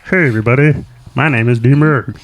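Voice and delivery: silly character voice introducing himself; put-on guy voice. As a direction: commit to the bit in the funny voice. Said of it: "Commit to the bit".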